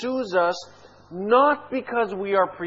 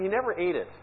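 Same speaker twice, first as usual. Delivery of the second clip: ordinary talk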